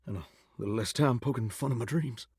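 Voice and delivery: soft, low gentle chiding; muted pointed aside. Acted. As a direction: slip it in quietly under your breath.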